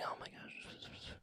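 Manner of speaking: slow whispered gasp; crowd murmur. Retold or imitated. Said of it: "The crowd is murmuring".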